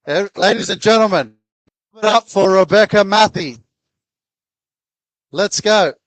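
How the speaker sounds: loud, high crowd-hyping announcer; hype MC. Real, not acted. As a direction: pump up the room like an MC. Host hypes crowd for guest.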